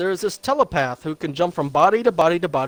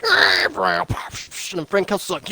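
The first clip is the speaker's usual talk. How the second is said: ordinary delivery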